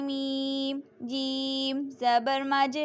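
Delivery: slow chanted spelling of مجرہا; spelling aloud. Shown as a demonstration. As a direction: spell out letter by letter, teacher style. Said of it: "امالہ کے ہجے اس طرح کریں".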